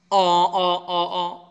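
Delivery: "অ" repeated as a distinct vowel; teacher drilling the "অ" vowel; pronunciation demo. Shown as a demonstration. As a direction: sound out "অ" several times, starting loud. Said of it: "অর মতো উচ্চারণ হবে".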